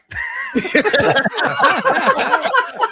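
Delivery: real high-pitched shriek of laughter. Real, not acted. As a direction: burst into a squealing cackle. Reading funny chat comment.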